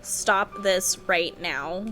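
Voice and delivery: usual speaking voice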